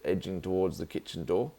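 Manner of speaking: ordinary delivery, nothing marked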